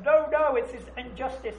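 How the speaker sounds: loud, high "no, no" of outrage; mock-indignant outcry; indignant protest. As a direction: protest the injustice loudly. Other person protests the suffering.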